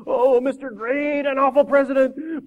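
high, drawn-out grovelling wail; hammed-up tearful awe; blubbering. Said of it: "blubbered the man".

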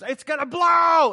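a sudden high shout that the bomb is gonna blow; mock panicked shout. Preacher acting out a bomb about to go off, loud mock panic. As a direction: yell it like the fuse is about to hit.

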